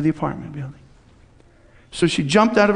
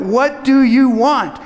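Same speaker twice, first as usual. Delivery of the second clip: higher, slower and louder, each word pressed; slow blunt demand; 6 semitones higher, 4 dB louder, slower than usual. Retold or imitated. Pastor slowly presses the woman to say what she wants.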